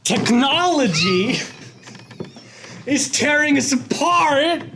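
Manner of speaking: high, fast frantic ranting; Nick Cage rant. Acted. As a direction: rant wildly like Nicolas Cage. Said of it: "calm down, Nick Cage".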